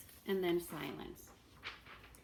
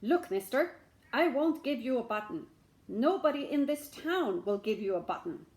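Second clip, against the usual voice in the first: louder, blunt refusal; gruff shamus; 3 semitones higher, 7 dB louder than usual. Acted. Caretaker refusing the beggar, gruff. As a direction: refuse flatly.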